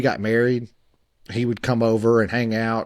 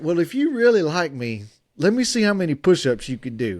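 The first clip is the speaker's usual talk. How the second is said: put-on high, teasing Georgia girl voice, much higher; mock Georgia belle; 7 semitones higher than usual. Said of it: "she's got this Georgia accent".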